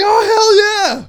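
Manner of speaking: high, loud shout of pure joy; excited shout. Retold or imitated. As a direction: pop like a fan in the arena. Retells popping for Stone Cold.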